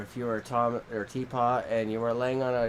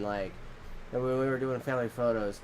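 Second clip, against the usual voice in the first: normal talking voice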